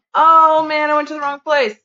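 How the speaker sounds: high, loud exclamation of dismay; loud, high-pitched "oh no" reaction; real dismay. Real, not acted. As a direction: wail over your own wrong turn. Dismay at own navigation mistake.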